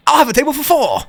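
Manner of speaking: high-pitched, exaggerated British accent; mock British accent. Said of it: "with, with the accent".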